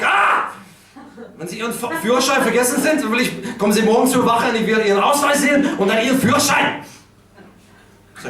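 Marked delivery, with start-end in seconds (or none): 0.0-6.8 furious German shout. Retold or imitated. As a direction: yell it like an outraged cop. Policeman ranting in German.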